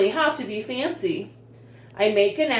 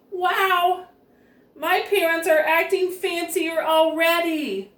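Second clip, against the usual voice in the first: much higher, delighted wow; amazed wow; 7 semitones higher than usual. Acted. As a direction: gasp with delight at the parents. Nancy amazed at her parents.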